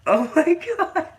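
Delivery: very high squeal through real laughter; helpless laughter. Real, not acted. Host unable to finish the nomination, squealing with laughter. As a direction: wheeze it out, unable to get through the sentence. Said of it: "I can't even finish".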